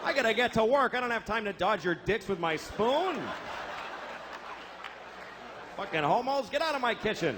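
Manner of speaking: griping at intruders, playing it up; acted exasperation. Man trying to get to work, acted exasperation. Annoyed man at breakfast table.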